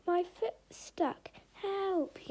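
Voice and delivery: high, slowed, desperate calls; a high, drawn-out cry for help; yelling for help. A narrator's reading. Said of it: "yelled the monkey".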